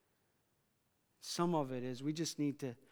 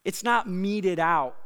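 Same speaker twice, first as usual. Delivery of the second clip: ordinary talk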